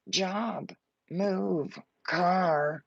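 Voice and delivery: "job" whispered. Real, not acted. Reader listing outcomes, whispering "job".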